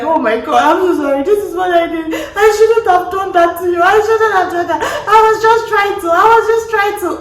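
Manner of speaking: high hammed-up crying apology; mock sobbing confession. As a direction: wail the confession, half laughing. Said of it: "confess your sins like".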